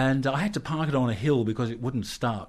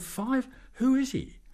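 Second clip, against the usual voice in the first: high, rising disbelief; astonished; 14 semitones higher, slower than usual. Retold or imitated. Asking who the famous man is, high and astonished. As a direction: squeak out the question in disbelief.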